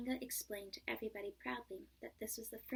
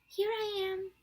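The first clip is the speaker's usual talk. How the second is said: much higher, louder little-kangaroo squeak; squeaky Roo; 10 semitones higher, 9 dB louder than usual. A narrator's reading. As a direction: squeak it out proudly. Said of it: "squeaked Roo".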